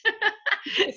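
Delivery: bright high laugh at her own story; a warm chuckle; amused laugh. Real, not acted. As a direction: let a real laugh bubble up.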